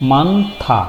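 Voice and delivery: মানথা slowly, in two syllables মান and থা; slow model reading of মানথা; pronunciation demo. Shown as a demonstration. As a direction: sound out মানথা for learners. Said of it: "আমরা একটু উচ্চারণ করে আসি".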